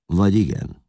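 dark retort. A narrator's reading. Attila answers about the emperor, mildly dark retort. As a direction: answer back with understated threat. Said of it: "felelte sötéten Attila".

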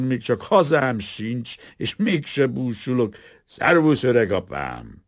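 put-on a maudlin drunk hugging his friend; drunk and moved. Carpenter, drunk and emotional, embraces the old watchman. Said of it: "az ácslegény részegen ölelgette, és meghatott szavakat motyogott".

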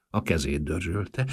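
plain reading voice

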